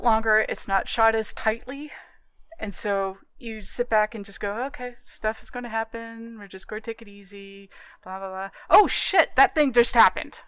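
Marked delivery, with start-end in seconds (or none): 4.7-8.5 soft, laid-back mock inner voice; imitating a relaxed viewer. Mock viewer settling in calmly. 8.7-10.4 higher, louder sudden jolt; suddenly startled, oh no; mock shock.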